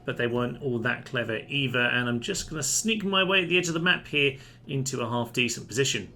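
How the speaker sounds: normal talking voice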